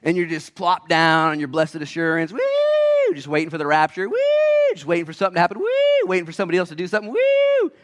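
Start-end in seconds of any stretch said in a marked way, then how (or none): 2.3-3.1 mock cheer. Mocking complacent Christians' cheer. 5.6-6.1 mock cheer. Mocking complacent Christians' cheer. 7.1-7.7 mock cheer. Mocking complacent Christians' cheer.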